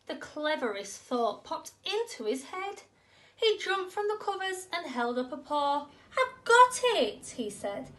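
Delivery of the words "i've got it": high, loud and slow, bursting with the idea; a mouse shouting out his big discovery; excited eureka. Acted. Mouse has a sudden idea.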